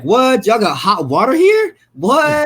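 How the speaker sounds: high, loud mock astonishment; incredulous outburst, pitched high; retold amazement. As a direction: play the kid stunned by hot water. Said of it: "I was like".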